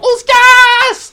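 high, slow, loud shout of panic; panicked yell. Acted. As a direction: cry it out while running away. MJ joue les personnages en fuite. (le MJ crie pour les personnages en fuite, cri paniqué aigu et lent)

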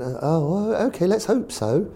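put-on high-pitched posh accent; Eddie Rogers. Chairman answers his manager politely, mock posh high voice. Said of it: "'cause very posh, Eddie".

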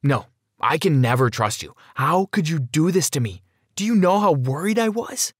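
upset outburst, pitch climbing high; hurt, escalating protest; cutting her off angrily. A narrator's reading. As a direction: accuse your parents, rising with each question. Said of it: "but I cut her off".

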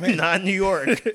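laughing, slow and loud. Real, not acted. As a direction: laugh through the words. Joking about post-covid states.

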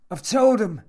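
high, loud outburst; indignant old man. A narrator's reading. Old man objects to intruder in his room, indignant. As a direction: protest loudly, pitched right up.

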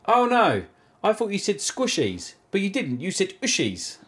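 high voice mocking the worker; mimicking shop worker. Mocking the shop worker's excuse in a high voice. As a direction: pitch it up as the worker.

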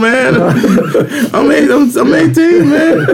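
high-pitched laughing protest. Retold or imitated. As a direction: laugh through the words, pitch way up. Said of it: "I was like".

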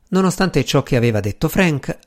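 ordinary delivery, nothing marked

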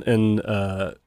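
normal talking voice

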